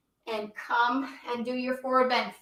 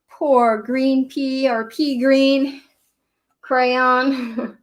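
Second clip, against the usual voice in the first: ordinary delivery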